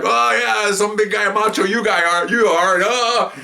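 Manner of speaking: high-pitched sarcastic taunting; mocking jeer. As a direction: imitate a crowd ribbing a would-be tough guy, voice up high. Group mocks the macho man.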